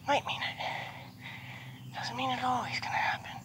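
soft, breathy reassurance to the horse; soothing whisper. Real, not acted. Trainer soothing a jumpy mare, gentle whisper. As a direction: whisper her calm, you're okay.

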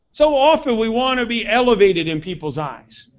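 usual speaking voice

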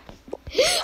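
sharp high gasp of delight; mock gasp. Mom's dramatic gasp.

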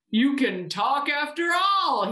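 high, slow, drawn-out amazement; astonished cry. A narrator's reading. Bear hears the teddy talk, crying out amazed. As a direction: cry it out slowly in wonder. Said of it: "he cried".

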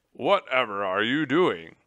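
loud, astonished question; crying out. A narrator's reading. Tum Tum to the upside-down general, crying out. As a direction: exclaim it with disbelief. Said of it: "Tum Tum cried".

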